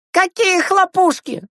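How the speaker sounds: very high, drawn-out exasperated cry; high kid voice, exasperated disbelief; losing patience. Acted. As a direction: explode like an impatient child. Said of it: "потерял терпение Андрейка".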